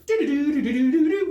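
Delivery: high, loud sing-song phone ring; mock ringtone in doo-doo syllables; imitated ringtone. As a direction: mimic a cell phone ringing out. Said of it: "whatever cell phones make".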